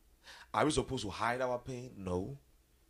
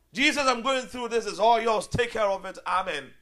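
mock prayer; 10 semitones higher, 10 dB louder than usual.

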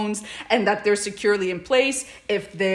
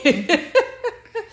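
high giggle; 10 semitones higher than usual. Real, not acted. Laughing after stop me.

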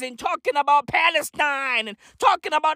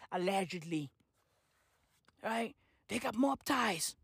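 quiet aside; 6 semitones lower, 15 dB quieter than usual. Acted. Dropped aside.

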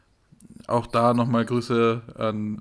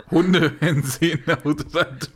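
laughing; 4 semitones higher, 3 dB louder, slower than usual. Real, not acted. Laughing at own absurd joke.

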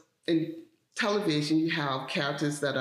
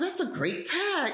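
high, fast, lightly voiced baffled colleague; puzzled coworker; 13 semitones higher than usual. The baseball-loving coworker brushing off her upset, high and quick. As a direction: toss it off quick and high like he doesn't get it.

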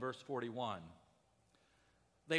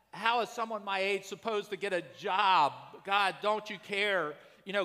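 pleading with God; 4 semitones higher, 6 dB louder than usual. Retold or imitated. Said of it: "you've asked that question of Jesus".

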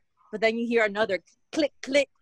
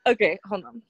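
ordinary talk, nothing marked

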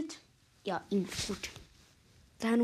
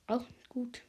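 whispering. Real, not acted. Quiet aside while playing.

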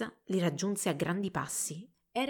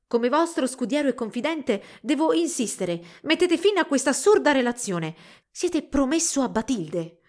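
raised, emphatic pleading; insistent; 5 semitones higher, 5 dB louder than usual. A narrator's reading. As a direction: press your master firmly to stop. Worried squire insists with his master.

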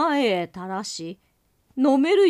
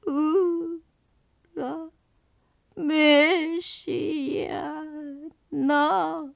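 high, very slow crying ghost wail; 6 semitones higher, much slower than usual. Acted. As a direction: sob it out as a mournful ghost. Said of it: "やっぱりひどく哀れな声で".

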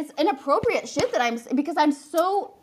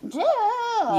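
high mocking "Duh."; mocking nurse; 11 semitones higher than usual. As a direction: sneer it like a strict nurse, much higher. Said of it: "the asshole Nurse Ratched that's like".

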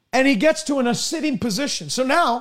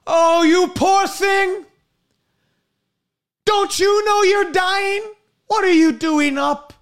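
high, slow, loud shocked exclamation; alarmed scolding; 6 semitones higher, 4 dB louder, slower than usual. Acted. Nurse finds the dying patient shaving and cries out, high and slow. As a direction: call out in shock at someone out of bed.

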